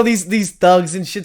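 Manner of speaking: normal talking voice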